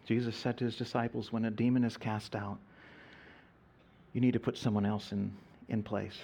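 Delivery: low, soft, settled explanation; hushed, calm retold counsel; gentle counsel. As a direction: guide someone quietly after a storm. Gently counsels the woman once calmed.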